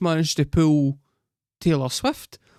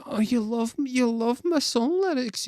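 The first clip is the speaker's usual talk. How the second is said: mock smitten girl; 9 semitones higher than usual. Mocking the girl's delight at Barry's praise, high and gushing. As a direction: coo it, flattered and giddy. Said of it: "And she's like".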